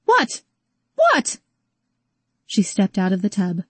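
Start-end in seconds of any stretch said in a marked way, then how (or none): 0.1-1.4 very high furious What; shrieked challenge. Acted. Rose confronts shocked Calvin, shrieking in anger. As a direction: hurl the word at him.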